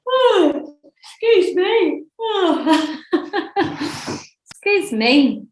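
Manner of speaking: high words through a yawn; talking mid-yawn. Real, not acted. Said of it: "I'm yawning".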